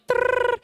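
high trilled phone ring; ringing phone imitation. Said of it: "my phone's like".

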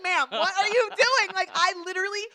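high, very loud incredulous challenge; exasperated mock shout. Storyteller challenging the glaring short lady, exasperated shout. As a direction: belt it out in comic disbelief.

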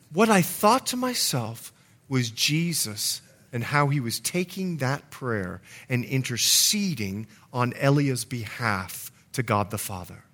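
normal talking voice

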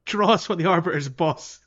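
normal talking voice

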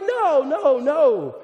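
a crowd of kids recoiling in mock disgust; mock loud refusal. Preacher imitating youth group kids refusing the water, mock loud refusal. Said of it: "Everyone's like".

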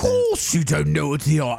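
hammed-up Northern English accent. Shown as a demonstration. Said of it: "Northern accent".

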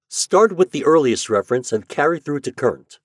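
crisp and direct, giving orders to a machine; Shandor's voice. A narrator's reading. Shandor asks the library index for files, brisk.

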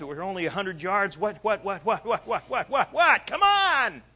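building from anxious to a high, loud desperate cry; a panicked father losing patience, rising to a yell; frantic urging. Acted. Frantic Jairus urging Jesus.

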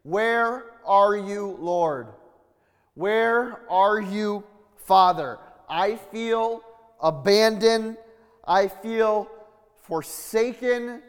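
slow, high, loud, aching plea; abandoned plea. Acted. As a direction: drag it out, utterly alone. Voicing Jesus' abandoned plea.

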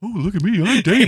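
high, silly mocking voice. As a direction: squeak out a goofy impression. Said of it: "I prefer this voice".

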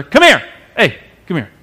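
put-on a stern father calling his kids over; firm summons, a hint of a stern dad. A father summoning his kids after a fight, stern call. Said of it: "It's my dad voice".

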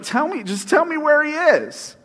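Mary urging the angels; pleading Mary. Retold or imitated. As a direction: push hard to get an answer. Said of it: "She's like".

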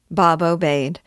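usual speaking voice

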